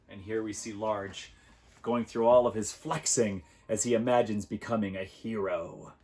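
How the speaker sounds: plain reading voice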